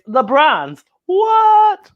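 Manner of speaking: ordinary delivery, nothing marked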